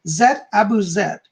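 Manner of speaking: ordinary talk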